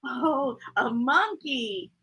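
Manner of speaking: usual speaking voice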